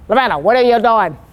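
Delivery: imitating a woman's broad Boston voice; quoted line in a broad Boston accent. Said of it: "In her broad voice and vo-, Boston voice".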